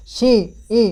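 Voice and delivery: छी, ई with the long ee through the nose; nasalised ee. Shown as a demonstration. Teacher sounding out the anusvara, long nasal ee in छी, ई. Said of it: "ई की आवाज़ आ रही है".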